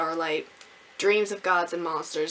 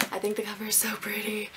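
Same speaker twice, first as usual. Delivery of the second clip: whisper; 6 dB quieter, faster than usual. Real, not acted. Gushing over new book cover.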